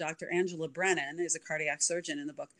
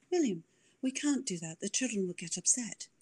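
Mary voice; 3 semitones higher, 4 dB quieter than usual. Shown as a demonstration. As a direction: lift it up and fret gently. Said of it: "she talked like this".

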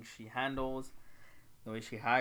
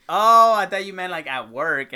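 startled realization, much higher and louder; surprised; 8 semitones higher, 20 dB louder than usual. Real, not acted. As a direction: caught off guard, suddenly getting it. Realizes she was meant.

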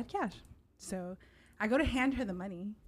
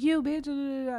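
higher, louder mock drunken insult; drunk girl picking a fight; 6 semitones higher, 4 dB louder than usual. The drunk girl in Cabo confronting her, mock angry. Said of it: "she was just like".

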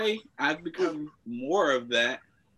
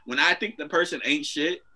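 ordinary delivery

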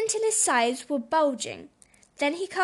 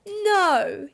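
loud, high storybook roar; a bear's booming, drawn-out no; 6 semitones higher, 5 dB louder than usual. A narrator's reading. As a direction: roar it out as a big bear. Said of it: "he roared".

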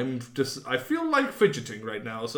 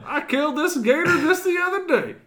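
Florida man voice; 13 semitones higher, 5 dB louder than usual. Retold or imitated. Mocking Florida local.

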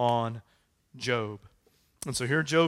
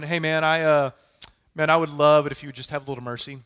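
louder breezy chatty ask, fast; casual mock request; 8 dB louder, faster than usual. Not Job, just a breezy friend asking for a bit of mercy, louder and fast. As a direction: toss off a casual favour, fast and easy. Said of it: "this isn't Job ... having a conversation going".

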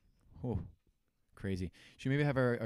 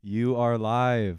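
loud, very slow declaration; emphatic announcement; 2 semitones higher, 9 dB louder, much slower than usual. Real, not acted. Host telling surprised caller she's on air, slow emphatic announcement. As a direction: spell it out slowly for the caller.

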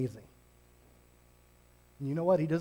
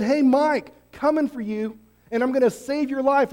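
louder, higher hail to a friend; Jesus calling out; 7 semitones higher, 6 dB louder than usual. Acted. Jesus calling out to Ray, hailing him loud and high. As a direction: call him by name across the room. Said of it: "He said".

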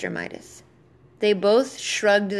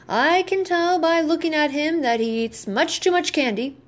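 higher-pitched doctor character giving a verdict; pronouncing doctor; 7 semitones higher than usual. A narrator's reading. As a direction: play the doctor sizing up a patient, voice lifted.

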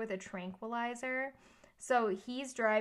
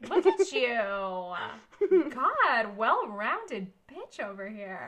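mock impressed; 2 semitones higher, 8 dB louder than usual. Real, not acted. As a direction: make a big show of being impressed. Teasing cohost for knowing a word.

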